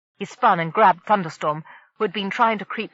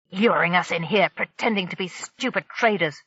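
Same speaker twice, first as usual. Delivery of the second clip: usual speaking voice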